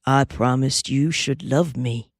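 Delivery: plain reading voice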